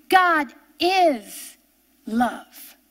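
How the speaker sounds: ordinary delivery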